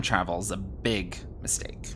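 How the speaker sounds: soft whispered aside; whispering. Acted. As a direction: whisper it under your breath.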